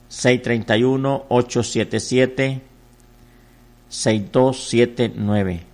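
very slow, drawn-out digits in steady groups; phone number read-out. Real, not acted. Pastor repeating the number for voluntary offerings, slow drawn-out read-out. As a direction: stretch each digit in a repeated telethon cadence.

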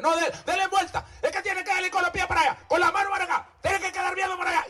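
high, fast fussy instructions; mock fussy relatives. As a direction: mimic bossy mourners. Mocking fussy family at funeral.

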